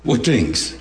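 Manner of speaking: with a smile. Shown as a demonstration. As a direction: let a knowing smile colour "What things?". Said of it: "he had to say this with a smile on his face".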